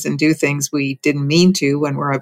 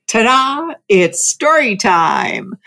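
high, drawn-out ta-da announcement; gleeful child; 6 semitones higher, slower than usual. Retold or imitated. Grandchild calls down the stairs to grown-ups, gleeful. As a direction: imitate a child's triumphant singsong entrance.

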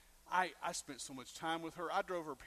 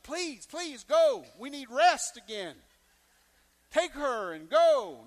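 higher, much louder, slow exasperated plea; weary parents begging comically; mock pleading parents; 5 semitones higher, 12 dB louder, slower than usual. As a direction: beg them to leave, hammed-up.

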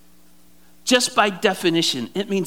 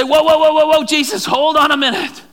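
urgent call to stop; 7 semitones higher, 5 dB louder than usual. Acted. Friends stop Jesus on the road.

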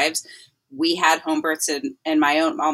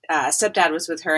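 ordinary delivery

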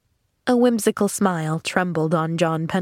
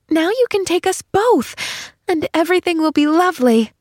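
put-on delighted little girl; 10 semitones higher than usual. A narrator's reading. Girl planning for us both, high and delighted. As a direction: gush happily as a little girl.

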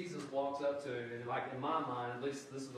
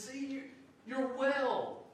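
high, bright exclamation; excited; 12 semitones higher, 5 dB louder, much slower than usual. Shown as a demonstration. Preacher reading Jesus' words as an exclamation, high and excited. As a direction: read it as a happy exclamation. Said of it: "there's an exclamation point there. It means he's excited".

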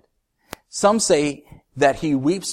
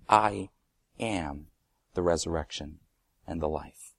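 hushed scripture; 7 semitones lower, 5 dB quieter than usual. Shown as a demonstration. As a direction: let each word fall quietly. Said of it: "quiet slow reading of verse".

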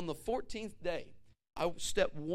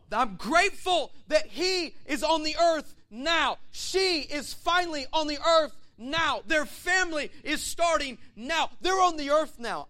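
heaven rejoicing; 8 semitones higher, 8 dB louder, slower than usual. Acted.

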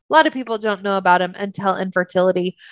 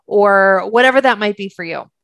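normal talking voice

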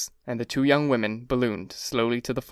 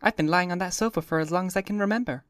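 put-on higher, languid young woman; high, lightly voiced cool athlete; a hint of bored Miss Baker; 6 semitones higher than usual. A narrator's reading.

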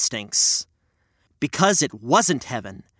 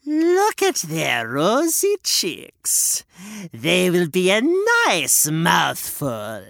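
greedy witch; slower than usual. Acted. Witch eyes the sleeping children, gloating hag. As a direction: savour every word.

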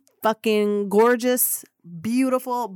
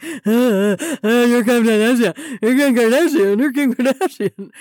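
starstruck babbling; 2 semitones higher, 8 dB louder than usual. Retold or imitated. Nervous fan blurting at Kim Kardashian, loud and dazed. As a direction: gush it over and over, dazed. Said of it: "I'm like".

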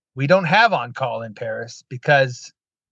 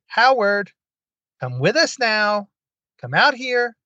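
summoning voice; 7 semitones higher, slower than usual. Retold or imitated. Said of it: "I just heard this, like, voice".

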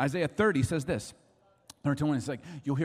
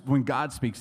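ordinary delivery

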